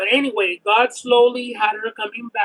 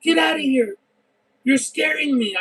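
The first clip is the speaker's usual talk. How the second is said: scared command; 6 semitones higher than usual. Retold or imitated. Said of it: "And I was like".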